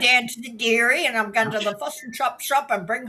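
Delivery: Kiwi accent. Shown as a demonstration. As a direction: put on a broad mock-Kiwi accent. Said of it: "This is how I hear Kiwis".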